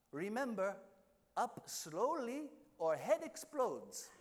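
a blunt captain in broken English, drawn out. Retold or imitated. Boat captain warning the scuba divers, slow broken English. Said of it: "a broken English".

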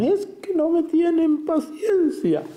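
put-on very high, slow whiny child voice; Chavo impression. Priest quoting el Chavo whining to don Ramón, high and slow. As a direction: whine it like a put-upon kid. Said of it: "el Chavo le decía a don Ramón".

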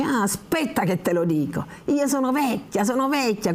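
put-on irritated old woman complaining; cranky old woman. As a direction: grumble like an offended old servant. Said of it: "la vecchia si arrabbia".

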